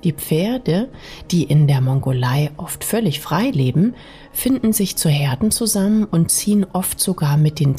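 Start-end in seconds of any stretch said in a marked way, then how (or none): none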